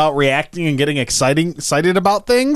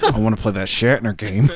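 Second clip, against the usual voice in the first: deadpan; 7 semitones lower than usual. Pretend. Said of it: "deadpan staring at everybody thinking".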